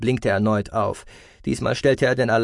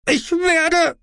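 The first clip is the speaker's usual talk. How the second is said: shouting; 6 dB louder than usual. A narrator's reading. Aspartamin keeps screaming, cut off.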